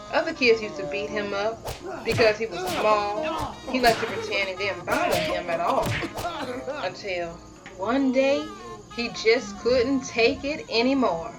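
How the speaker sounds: plain reading voice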